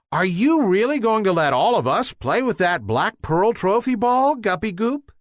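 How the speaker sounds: put-on high eager kids' voices asking; bright hopeful plea to play; excited guppies. A narrator's reading. Guppy friends ask Guppy Goop, high and eager.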